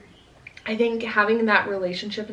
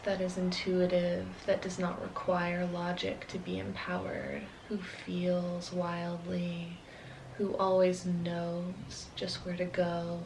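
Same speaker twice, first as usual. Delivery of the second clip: guided meditation; 8 dB quieter than usual. Acted. Inviting listeners to call upon the shadow self, guided meditation. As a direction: lead listeners gently inward, unhurried and hushed.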